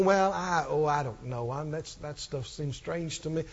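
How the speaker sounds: low, muttered half-hearted skeptic; doubtful skeptic. Acted. Imagined skeptic unsure about strange stuff, doubtful mutter. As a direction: hem and haw quietly, trailing away softly. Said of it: "if you're skeptical".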